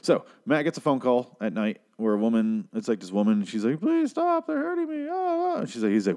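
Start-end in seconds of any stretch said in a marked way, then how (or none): 3.8-5.6 high mock pleading cry. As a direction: plead in a much higher voice. Woman pleading on phone.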